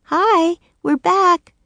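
put-on high girl calling to the room. Annie calling to the invisible children, cheerful call. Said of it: "she called to the invisible children".

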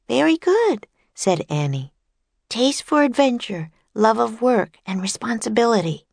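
put-on high, eager little girl's voice; bright young girl. A narrator's reading. Annie introducing herself and Jack to famous inventors, eager girl voice. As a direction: chirp it like an excited young girl.

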